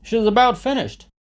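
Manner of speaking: high, loud urging; shouting. A narrator's reading. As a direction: shout for the kill. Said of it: "a third one shouted".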